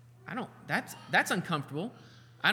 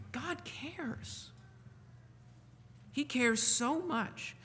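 tender; 8 semitones higher, 7 dB quieter, much slower than usual. Real, not acted. Tender emphasis in sermon.

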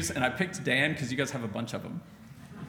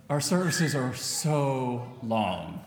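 complaining congregant; 3 semitones lower, much slower than usual. Retold or imitated. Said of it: "people coming to me saying".

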